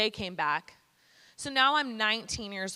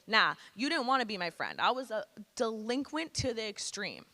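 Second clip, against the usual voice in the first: usual speaking voice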